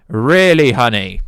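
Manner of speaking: loud, incredulous "Really, honey?"; sarcastic "really, honey". Real, not acted. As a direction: scoff at it like a pet name. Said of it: "Sorry, I say honey a lot".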